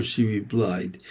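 ordinary delivery, nothing marked

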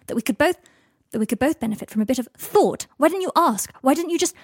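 building to indignant questions; sharp. A narrator's reading. Draco defending himself to an angry Harry, sharp. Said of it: "he said, sharp, directed at the floor".

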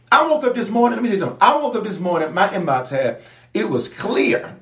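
high, lively build-up; brightly telling the tale; excited about a clear inbox; excited storytelling. Real, not acted.

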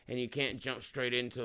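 usual speaking voice

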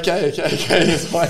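high, loud, laughing concession; husband backs away from his wife, high and laughing; 1 placating husband. Retold or imitated. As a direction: back off, hands up, laughing. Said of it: "I was like".

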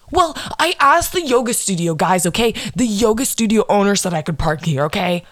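high, mocking whiny celebrity voice; mocking Kendall. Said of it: "She comes back out to yell at the paparazzi and goes".

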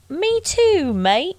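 high botched British, sliding into Australian; British turned Australian. Pretend. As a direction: aim for British, land on Australian. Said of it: "Oh, wait, that's Australian".

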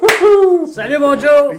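high, loud whoop; excited shout. Real, not acted. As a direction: burst in cheering. Guest enters shouting.

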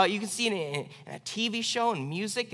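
ordinary delivery

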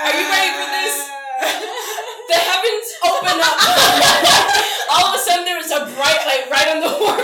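loud and high, barely holding back laughter; dramatic hype. Acted. Game master hypes the table for an entrance, excited. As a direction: build it up like a grand reveal.

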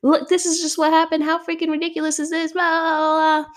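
high, whiny mock rant; high mock complaining, voicing her upset texts to Austin; venting to boyfriend. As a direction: replay my own upset texts in a high exaggerated voice. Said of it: "And I'm just like".

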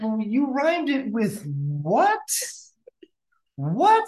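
slow, rising, high disbelief; rising baffled question; bewildered. Retold or imitated. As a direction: ask it slowly, baffled. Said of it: "I'm just like".

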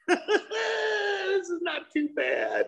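high, slow, laughing self-mockery; laughing mock satisfaction. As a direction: chuckle it out, pleased with the little fire. Content caveman finds fire.

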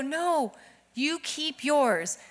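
soft, slow and drawn out; firm mother's refusal. Retold or imitated. Mom refuses daughter giving away her lollipop, firm. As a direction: spell it out slowly, quietly firm.

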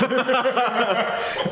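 high-pitched laugh breaking out; high burst of laughter. Real, not acted. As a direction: let out a high helpless laugh.

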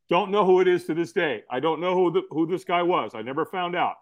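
usual speaking voice

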